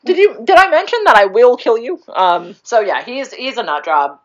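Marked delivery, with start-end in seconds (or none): 0.1-2.0 laughing mock. Real, not acted. Mocking the villain line.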